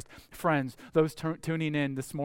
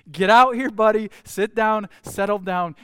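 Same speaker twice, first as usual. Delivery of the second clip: loud, raised-pitch dog commands; playful loud owner-to-dog call; 3 semitones higher, 9 dB louder than usual. Acted. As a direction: firmly order an excited dog to settle. Acts out commanding his excited dog.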